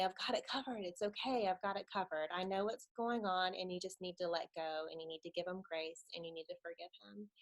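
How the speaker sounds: hushed, comforting words; gentle reassurance. Retold or imitated. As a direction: reassure tenderly, trailing down to very quiet. Acted Lord reassuring her.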